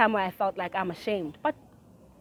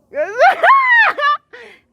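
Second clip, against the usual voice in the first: loud laughter; 11 dB louder than usual. Real, not acted.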